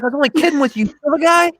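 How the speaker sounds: loud, high retold excuse; mock defensive outburst; imitated protest. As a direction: plead innocence in a pushed, high voice. Acting out quarrel's excuse.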